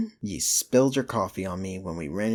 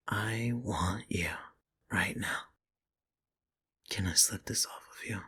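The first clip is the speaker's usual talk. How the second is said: seductive whisper; 5 dB quieter than usual. Real, not acted. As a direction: breathe the words close to the ear. Intimate seduction in bed.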